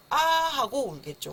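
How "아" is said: held, open-mouthed "ah" wail; yawn-like baby cry. Shown as a demonstration. Teacher shows how the sleepy baby cries, yawn-like cry. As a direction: cry out as if yawning wide. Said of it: "하품할 것처럼 크게 벌리고 소리는".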